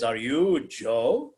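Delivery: lightly voiced islander asking slowly; hint of a solemn native greeter. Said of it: "this one native comes out".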